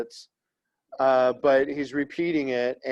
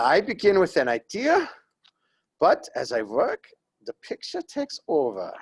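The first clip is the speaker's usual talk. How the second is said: quoting the painter in a hammed-up German accent; a playful, exaggerated German accent for the painter's words; mock German accent; 5 semitones higher than usual. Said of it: "I'm sorry for that accent".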